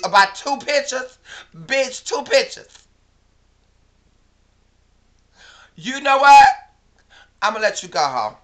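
loud, high disbelief, slowing on the last words; exasperated yelling. Real, not acted. Yelling at the friend over two pictures, exasperated disbelief. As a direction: spell out how petty it is, loudly.

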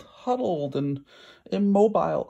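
ordinary delivery

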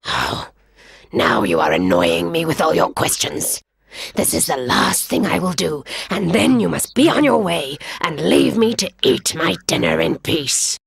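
very low, irritable growl; lion losing patience; annoyed lion. Acted. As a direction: brush off the pest.

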